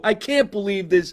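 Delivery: high, loud showman's disbelief; hammed-up amazement. Host sees his surprise guest, hammed-up amazement. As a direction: play up the shock for the audience.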